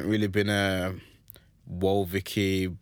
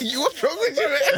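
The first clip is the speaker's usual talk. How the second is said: high laughing question. Real, not acted. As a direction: laugh out the words. Laughing fit.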